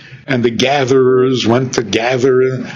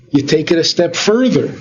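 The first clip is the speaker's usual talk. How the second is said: usual speaking voice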